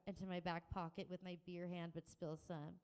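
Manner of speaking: plain reading voice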